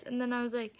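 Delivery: ordinary delivery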